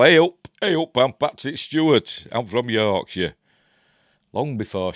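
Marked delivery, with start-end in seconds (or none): 0.0-3.3 hammed-up Yorkshire, high and loud, then slowing; mock young Yorkshire lad "Hey up"; broad Yorkshire accent. Vlogger imitating young Patrick Stewart, broad Yorkshire accent. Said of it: "But he were all".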